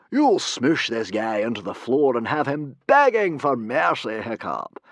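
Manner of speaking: loud hearty encouragement; rally him boldly; cry out. A narrator's reading. Humongous cheering Hiccup on, loud cry. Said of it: "cried Humongous".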